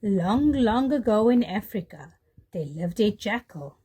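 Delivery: usual speaking voice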